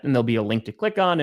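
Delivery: ordinary talk, nothing marked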